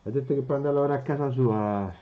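called out loud and fast; calling out. Real, not acted. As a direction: call it across the room. Calling across the room.

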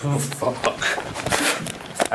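hushed, breathy oh fuck; whispered embarrassed swear; muttered curse. Retold or imitated. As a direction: caught out, curse under your breath.

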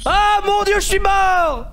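high, loud cry of dismay; dismayed shout. Real, not acted. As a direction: shout as your character dies. Dies at the last moment.

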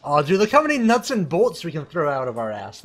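loud, high, thrilled exclaim; excited. Real, not acted. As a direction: hype it up over the loot. Excited about the car's effect.

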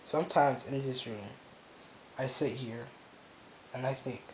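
hushed and hopeless, barely above a murmur; quiet, broken confession; quiet despair. Real, not acted. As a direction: speak low like you have given up. Quiet despairing confession alone in room.

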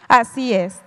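ordinary talk

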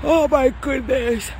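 slow yawned words; yawning. Real, not acted. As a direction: say it mid-yawn. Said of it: "I'm yawning".

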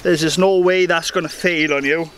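ordinary delivery

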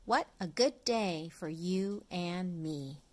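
plain reading voice

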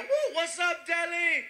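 high, whooping stage shout; hyped crowd greeting. Retold or imitated. Comedian runs on stage to chanting crowd, hyped greeting. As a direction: rev up the audience.

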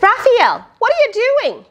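startled parent calling; mother calling out. Acted. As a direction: call out like a surprised mom. Parent voiced in story.

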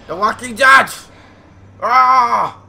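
loud hammed-up title ending in a yell; screamed title read. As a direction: belt out the title, then just yell. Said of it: "Why am I screaming?".

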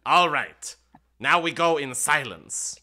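loud, triumphant imp. Acted. Imp announcing the rooms to players, triumphant and loud. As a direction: announce it like a gleeful demon.

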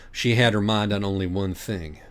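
ordinary delivery, nothing marked

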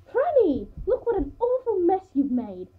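very high, loud, shrieked telling-off; high-pitched, loud maternal outrage; scolding. A narrator's reading. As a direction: scold like an appalled mother. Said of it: "my mother scolded me".

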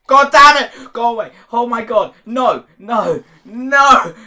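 high-pitched panicked screams; real screaming. Real, not acted. Player attacked by a creeper, screaming in panic. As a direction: scream at the top of your voice. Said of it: "screaming at the top of your voice".

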